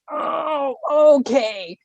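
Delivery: high, drawn-out mock realization; knowing exasperation. Every woman relating to Cassandra, mock exasperated recognition. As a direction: stretch it out in pointed recognition. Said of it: "every woman in the, on the planet".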